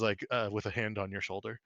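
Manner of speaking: normal talking voice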